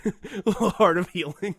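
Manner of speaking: giggling through a misheard phrase; laughing. Real, not acted. Teasing friend over his slip, laughing. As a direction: laugh while repeating it back. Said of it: "Wait, what'd you say?".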